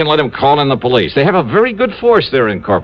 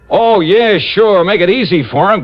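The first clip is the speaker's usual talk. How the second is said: sarcastic; 6 semitones higher than usual. Acted. Angry sarcastic reply to Frank.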